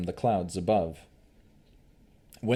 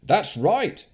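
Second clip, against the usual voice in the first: townsperson voice; 11 semitones higher, 6 dB louder than usual. Acted. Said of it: "said another person".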